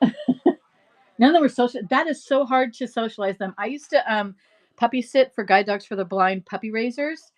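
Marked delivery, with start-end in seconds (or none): none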